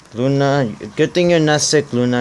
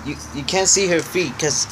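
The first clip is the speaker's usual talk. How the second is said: ordinary talk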